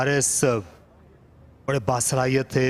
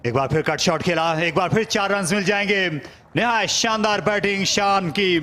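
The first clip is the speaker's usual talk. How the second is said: excited cricket commentary; 6 semitones higher than usual. Real, not acted. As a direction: lift the voice as the four comes. Boundary four, commentator rises. (उत्साहित cricket commentary)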